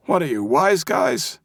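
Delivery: high, crabby mock-brave holler; old man's bluffing yell. A narrator's reading. Old man confronting four men by the car, bluffing bravely. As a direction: yell high, putting on bravado. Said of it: "he yelled, trying to sound braver than he felt".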